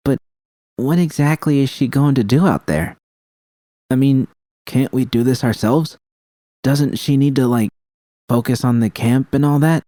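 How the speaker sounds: lightly voiced Judy, hesitant and questioning; Judy, doubtful. Judy questions V about bringing Panam, lightly voiced doubt.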